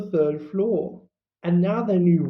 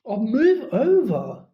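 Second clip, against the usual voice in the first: slow impatient growl, a touch higher; exasperated push past the boys; irritated snarl; 5 semitones higher, 4 dB louder than usual. Acted. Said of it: "Hermione snarled".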